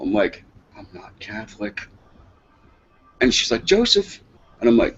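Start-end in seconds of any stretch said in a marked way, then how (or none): 0.8-1.8 very soft objection; hushed, reluctant correction; retold meek protest. Timid protest to grandmother, retold. 3.6-4.2 high-pitched reproving name-call; mock-grandma reproach, pitched up high; imitated scolding grandmother. Grandmother scolds supposed son.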